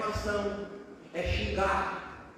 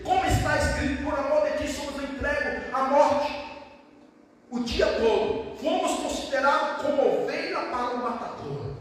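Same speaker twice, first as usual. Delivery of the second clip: raised-pitch, emphatic verse reading; declaimed scripture; 5 semitones higher, 4 dB louder than usual. Acted. Preacher quoting the sheep verse to believers, declaimed scripture. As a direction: proclaim the verse to the room.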